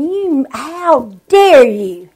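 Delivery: slow, indignant inner voice; mild retold fury at the pastor's board; remembered anger. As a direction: drag out the hurt, restrained outrage. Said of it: "It made me so mad".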